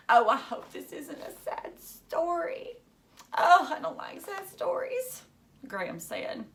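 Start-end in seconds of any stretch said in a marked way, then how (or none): 0.1-5.2 high, pleading little voice; worried child voice. Acted. Graham dreading a sad story, high worried whine. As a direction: whine it out like a fretful kid.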